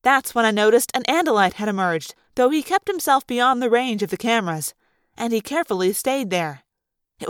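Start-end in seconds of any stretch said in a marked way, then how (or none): none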